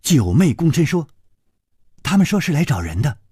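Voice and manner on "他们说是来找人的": fast, rising reply; quick polite report. Acted. 九妹 bows and reports quickly to her mistress. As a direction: rattle it off briskly, ending up.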